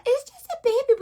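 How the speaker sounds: put-on high-pitched baby-talk. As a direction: coo it high, as over a baby. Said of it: "And so you just have".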